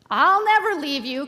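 Peter's vow, called out emphatically; crying out. A narrator's reading. Pastor tells children of Peter's promise to Jesus, crying out. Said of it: "cried Peter".

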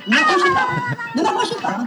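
shrill, frightened high voice; high frightened plea. Acted. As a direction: back away in high-pitched fear. Customer fends off intruder.

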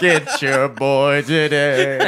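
slow, loud ad announcer voice, laughing; car ad announcer. Acted. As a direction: stretch it out like a TV spot. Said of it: "used car thing".

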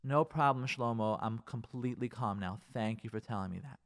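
soft, sarcastic, too sweet reply; mock calm wife. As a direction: lightly play a wife who is suddenly fine. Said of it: "She's returned to you and say".

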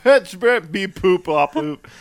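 loud, high beep-poop robot voice; host replaying his own joke bit, loud high robot beeps; retold robot bit. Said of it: "that little part where I was like".

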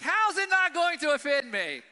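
loud, high, offended outburst; indignant retort. Retold or imitated. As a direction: throw it back at the letter, indignant. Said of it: "I just start getting mad".

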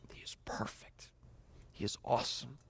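hushed, breathy whisper of awe; whispered awe before God. Real, not acted. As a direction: drop to a whisper in wonder. Awed hush in sermon climax.

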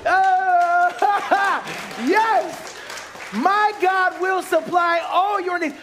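high, loud, slow laughing shout; joyful shouted faith; triumphant praise. Retold or imitated. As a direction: declare it like a victory. Triumph after check.

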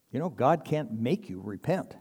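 ordinary talk

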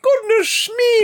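put-on high, slow old-fashioned exclamation; mock Doctor voice. Host hamming up a startled Doctor Who line.

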